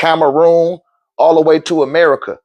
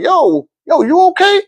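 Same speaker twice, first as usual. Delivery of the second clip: higher-pitched, imitating a worried friend; concerned check-in; 12 semitones higher than usual. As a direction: check on him in a raised, questioning voice. Retold friend checking on crying man.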